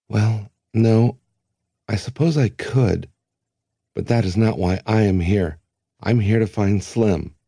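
put-on young Length. A narrator's reading. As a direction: Length holds his ground.

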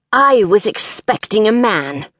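put-on gravelly, enunciated older-woman character voice; peculiar gravel voice. A narrator's reading. Wheelchair-bound employer greets an applicant, peculiar gravelly voice. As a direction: enunciate it as if through crunching gravel. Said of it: "Like crunching gravel?".

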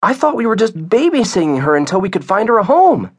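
put-on high-pitched excited child. A narrator's reading. Via questioning her dad about the dog, excited. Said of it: "Via said excitedly".

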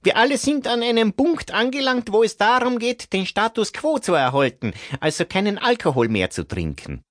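high, thin man's voice; character voice. Acted. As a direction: play a shrugging group member. Albert continues in group.

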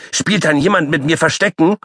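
high-pitched excited little animal; shrill repeated plea; squeaky child call. Acted. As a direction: chant it like a pestering puppy. Paule squeaks asking.

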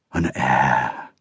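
low, soft, awed murmur; in wonder. A narrator's reading. Old king hearing his heir is born, in wonder. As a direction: breathe it out in disbelief. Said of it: "Uther said in wonder".

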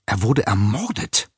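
a light hiss on "Er wurde ermordet", stress on "wurde"; cleaning woman hissing to the professor. A narrator's reading. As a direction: hiss it as a dark secret. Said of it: "zischte die Frau".

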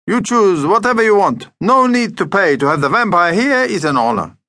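put-on high, effusive Greek-accented voice; generous Greek shopkeeper. Deli owner offers Mum free food, effusive. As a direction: insist grandly as a Greek shop owner.

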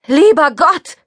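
high, loud cry of terror; panicked loud shriek; screaming. A narrator's reading. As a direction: scream it as the car charges at you. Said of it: "schrie ich".

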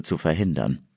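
plain reading voice

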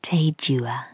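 a tender whisper in his ear. Acted. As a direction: breathe the foreign words softly. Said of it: "Then she whispers".

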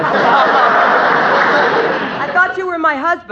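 wife baffled by husband's sudden romance, mock astonished; mock bewildered. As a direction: feign that she's walked into a stranger's arms. Wife startled by husband's ardour.

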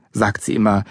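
usual speaking voice

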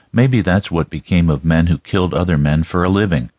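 ordinary delivery

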